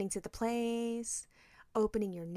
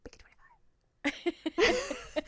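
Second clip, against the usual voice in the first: slow drawn-out whisper; 11 semitones higher, much slower than usual. Retold or imitated. Wife slowly whispering her final word on the tip. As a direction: stretch it out in a whisper.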